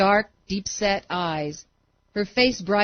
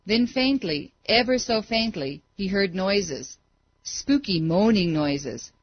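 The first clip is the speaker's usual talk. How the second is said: ordinary delivery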